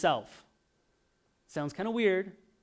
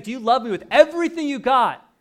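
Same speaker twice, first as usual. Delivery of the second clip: loud, high, urgent plea; pressing Jesus; 10 semitones higher, 14 dB louder than usual. Retold or imitated. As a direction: push the question out hard and high. Jesus pressing Peter, retold again.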